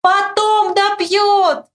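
very slow, high holler; high drawn-out yell back. A narrator's reading. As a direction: bellow it with long stretched vowels. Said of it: "ору я".

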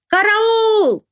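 high drawn-out cry, falling firmly at the end; frightened, emphatic cry. A narrator's reading. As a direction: cry for help as a definite statement. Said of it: "закричала уже не с вопросом, а совершенно утвердительно".

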